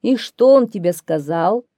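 loud, commanding lion voice; lion questioning. A narrator's reading. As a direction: demand an answer as king of beasts. (лев громко допрашивает)

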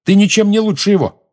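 high, loud accusation; indignant high-pitched yell; angry shout. A narrator's reading. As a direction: throw it at him, pitched right up. Lily shouts on at James.

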